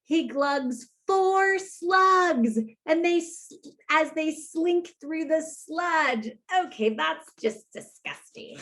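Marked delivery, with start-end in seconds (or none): 0.0-6.3 slow, sing-song counting rhyme; storytime refrain. A narrator's reading. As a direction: chant the list like a nursery rhyme. Storytime reading.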